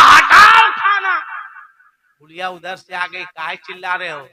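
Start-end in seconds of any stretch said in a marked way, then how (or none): 0.0-1.2 high, loud, slow shout; furious shout. Acted. Husband shouting at the table, loud and slow. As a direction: bark it to push the plate away.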